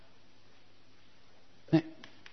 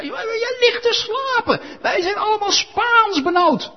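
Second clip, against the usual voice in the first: much higher, slow, wailing captain's voice; panicked ship's captain; 4 dB louder, slower than usual. Acted. Captain waking Jonah, high panicked wail. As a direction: wail it like a frightened skipper.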